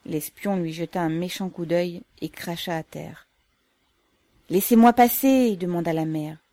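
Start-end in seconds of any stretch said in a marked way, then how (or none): 4.5-5.6 insistent plea. A narrator's reading. Burdened mother pleads to pass.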